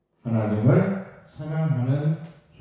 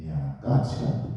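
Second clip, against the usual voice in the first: gentle father; 12 semitones lower, 4 dB quieter than usual. Retold or imitated. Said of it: "나에게 이렇게 물었다".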